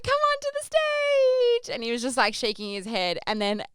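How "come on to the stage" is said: high, slow, sing-song coaxing; coaxing call. Retold or imitated. Host coaxing a stubborn guest up to the stage, high and slow. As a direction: lure him up to the stage.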